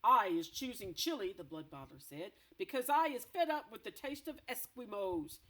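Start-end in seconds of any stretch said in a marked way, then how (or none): 0.0-1.3 loud, gloating giant boast; boasting brute giant. Acted. Blood Bottler boasting of Chile to BFG, loud gloat. 2.6-5.4 loud, gloating giant boast; boasting brute giant. Acted. Blood Bottler boasting of Chile to BFG, loud gloat.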